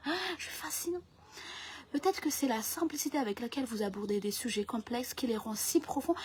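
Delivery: soft, measured interviewer voice; flattering French interviewer. Acted. Interviewer flattering an author about their book, hushed and reverent. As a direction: praise the guest quietly and earnestly.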